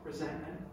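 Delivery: Resentment said very softly, weighted; quiet emphasis. Shown as a demonstration. Preacher naming the one word, hushed. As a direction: drop to almost nothing on Resentment. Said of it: "One word".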